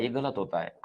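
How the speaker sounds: normal talking voice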